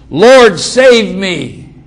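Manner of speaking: loud, slow cry for rescue; yelled plea. Retold or imitated. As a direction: call out as if sinking. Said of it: "And he yelled out".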